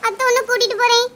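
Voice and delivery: loud, urgent talk over rushing water. Acted. Neighbours shouting plans to each other in the flood, loud and urgent. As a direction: call it out over the noise of the flood.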